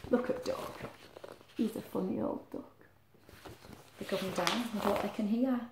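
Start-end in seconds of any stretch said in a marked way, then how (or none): none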